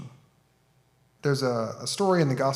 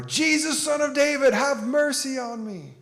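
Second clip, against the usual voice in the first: desperate yell, much higher and louder, slower at the end; 13 semitones higher, 4 dB louder than usual. Retold or imitated. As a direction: shout it harder so he can't be ignored. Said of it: "yell louder".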